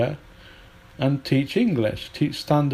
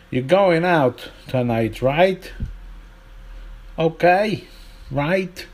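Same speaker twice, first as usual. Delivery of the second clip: punchy American tag question, higher on the tag; blunt American-style check, pitched up on the last word; American English; 4 semitones higher, slower than usual. Shown as a demonstration. Teacher showing American question tags, mock American.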